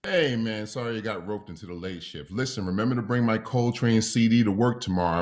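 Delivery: ordinary talk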